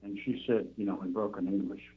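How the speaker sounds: ordinary delivery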